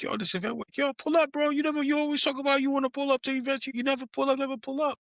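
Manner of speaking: very high, urgent nagging call; pleading invite. Retold or imitated. Urging the neighbor to come to events, very high and insistent. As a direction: beg a neighbor to finally come. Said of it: "I'm like".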